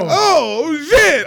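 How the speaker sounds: high rising shocked outburst. Retold or imitated. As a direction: blurt it when you see the police car. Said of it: "So we like".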